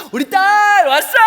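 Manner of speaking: very high, loud, slow and stretched welcome; hammed-up delight so the daughter won't suspect; overacted cheery greeting. Dad greets his daughter home, hammed-up joyful shout. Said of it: "열심히 연기를 했어요".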